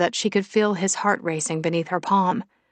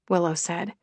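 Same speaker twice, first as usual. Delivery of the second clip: usual speaking voice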